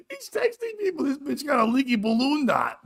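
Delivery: high voice squeezed through laughter; real helpless giggling as you speak; laughing incredulity. Real, not acted. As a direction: struggle to get it out while cracking up. Laughing while retelling the text.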